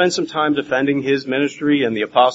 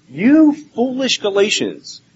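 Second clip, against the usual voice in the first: slow, high, scolding half-yell; mock yelling; 7 semitones higher, 4 dB louder, slower than usual. Preacher voicing Paul yelling at the Galatians, angry. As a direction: stretch it out like an exasperated scolding. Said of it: "he's kinda yelling at them".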